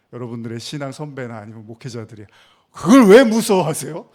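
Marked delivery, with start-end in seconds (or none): 2.7-4.1 loud, higher-pitched mock incredulity; dismissive reproach. Church elder answering a member's confided fear, mock reproachful. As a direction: throw the question back loudly.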